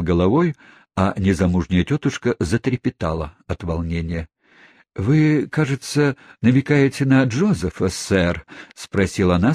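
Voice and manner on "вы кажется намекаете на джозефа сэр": high, tense question held in check; trying to stay composed. A narrator's reading. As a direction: fight to keep her voice calm. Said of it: "стараясь сохранить невозмутимый вид". (сдержанное волнение)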